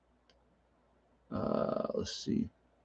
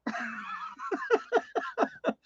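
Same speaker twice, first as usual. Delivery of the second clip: high laugh; 9 dB quieter than usual. Real, not acted. Laughs after mom imitation.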